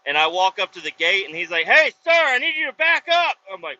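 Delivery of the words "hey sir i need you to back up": loud, high-pitched imitation of a guard; raised, urgent mock shout; retold guard's order. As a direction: call out a command like a security guard. Guard ordering him back, retold.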